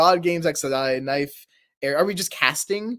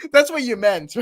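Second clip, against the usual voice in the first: high, louder, cracking up; laughing; 6 semitones higher, 6 dB louder than usual. Real, not acted. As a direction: say it through real laughter. Cracks up after the parody song.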